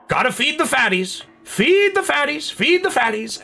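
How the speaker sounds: odd high-pitched sing-song customer; weirdest voice. Retold or imitated. Pet store customer chanting over cat food, weird high voice. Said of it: "always says that in the weirdest voice".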